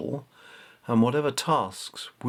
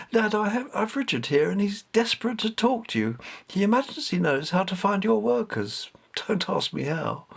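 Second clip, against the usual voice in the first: higher woman's voice, slightly flustered; flustered mother; 9 semitones higher than usual. A narrator's reading. Katie telling her father about Richard, flustered. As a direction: explain the odd request quickly.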